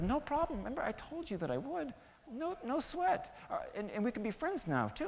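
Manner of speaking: high, easygoing little mouse voice; proud helpful mouse. Acted. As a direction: shrug it off in a high voice.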